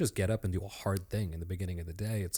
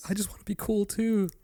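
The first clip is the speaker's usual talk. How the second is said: very high, small wannabe voice; hammed-up high-pitched plea; mock pleading; 12 semitones higher than usual. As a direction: whine it playfully up high. Echoing the joke as a wannabe.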